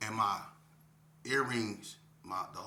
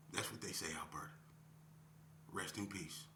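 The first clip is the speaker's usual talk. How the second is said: emotional; 4 semitones lower, 14 dB quieter than usual. Real, not acted. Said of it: "I'm kinda getting emotional".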